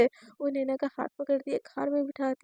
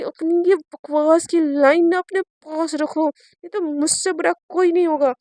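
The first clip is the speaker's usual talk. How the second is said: angry warning; 8 dB louder, slower than usual. Acted. Sister cuts off cheesy line.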